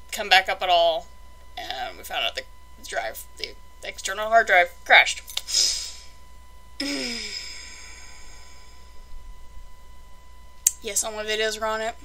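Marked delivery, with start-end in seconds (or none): 5.3-10.8 long sigh over a loss; long, weary exhale; dejected sigh. Real, not acted. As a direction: let out a heavy, drawn-out sigh. Hard drive crashed with all videos.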